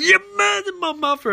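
very high-pitched, hammed-up outcry; anguished mock accusation. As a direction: accuse in an exaggerated high wail. Barry accuses his mother's killer.

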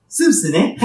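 put-on scheming old boss sizing up a name; Mr. Burns. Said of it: "Burns reads the application and says".

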